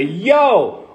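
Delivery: sharp call across the street. Shown as a demonstration. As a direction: call out to turn heads. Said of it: "when you say".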